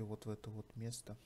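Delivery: usual speaking voice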